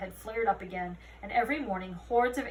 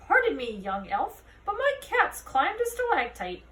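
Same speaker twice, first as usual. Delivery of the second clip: put-on higher, fretful old voice; anxious tourist; 6 semitones higher, 5 dB louder than usual. A narrator's reading. A tourist asking Holly to rescue a cat. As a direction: plead for help with the cat.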